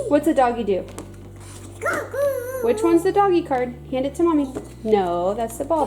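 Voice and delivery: high, bright sing-song praise and questions. Real, not acted. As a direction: coax a little one to play. Mom quizzing toddler with cards.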